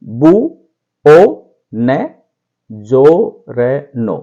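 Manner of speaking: बू ओ न जो रे नो, each syllable apart; teacher slowing a word down. Shown as a demonstration. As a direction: break बुongiorno into separate beats. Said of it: "बोलेंगे".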